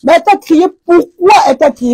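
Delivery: preacher raising her voice at the viewers; yelling at you. Real, not acted. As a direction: shout the question at them. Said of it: "je vais même crier sur vous".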